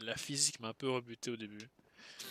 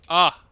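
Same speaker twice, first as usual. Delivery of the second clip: startled yelp; 6 semitones higher, 19 dB louder than usual. Real, not acted. Sudden hit.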